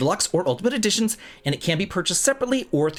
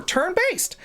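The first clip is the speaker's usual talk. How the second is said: mock excitement; 14 semitones higher than usual. Real, not acted. Mocking the press blurb.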